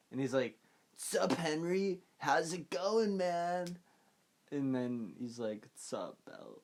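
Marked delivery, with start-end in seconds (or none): 1.0-3.8 high, drawn-out goofy undead greeting; high-pitched zombie pal, slowly stretched words; rotted corpse buddy. Retold or imitated. Voices rotted friend Belch's greeting. 5.8-6.6 low, soft deadpan greeting; hushed, much lower acted reply; subdued reply. Voices Henry's answer to Belch.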